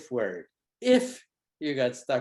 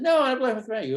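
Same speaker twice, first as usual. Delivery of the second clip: put-on whiny angry kid; 10 semitones higher, 7 dB louder, faster than usual. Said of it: "And you said".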